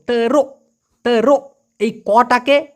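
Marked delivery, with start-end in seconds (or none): 0.1-1.5 "তেরো" with the final k cut off in a throat catch; ধাক্কা দেওয়া উচ্চারণ. Shown as a demonstration. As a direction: say "তেরো", stopping the end abruptly in the throat. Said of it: "উচ্চারণটা হবে". ("তেরো"-র শেষে গলায় হালকা ধাক্কা)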